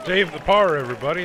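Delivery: high, loud celebratory call; excited shout. Real, not acted. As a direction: announce it with a loud cheer. Celebrating a par save.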